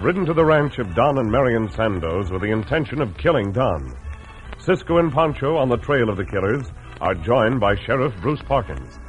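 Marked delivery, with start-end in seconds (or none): none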